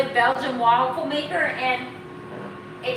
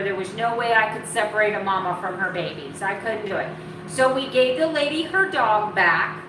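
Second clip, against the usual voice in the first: ordinary delivery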